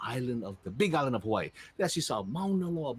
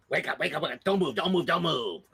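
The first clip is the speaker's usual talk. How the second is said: urgent shark chief; 3 semitones higher, 4 dB louder than usual. Acted. Shark chief rouses minions.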